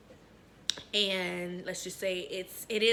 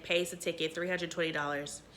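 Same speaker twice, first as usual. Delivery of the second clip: usual speaking voice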